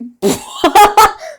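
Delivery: high, loud burst of laughter. Real, not acted. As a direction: crack up at your own bad accent. Cracks up at own accent.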